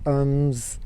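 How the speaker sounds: usual speaking voice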